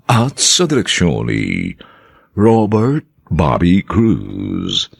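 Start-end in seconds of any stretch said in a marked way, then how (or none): none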